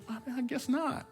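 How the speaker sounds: sheepish concession. Acted. Balaam concedes to donkey.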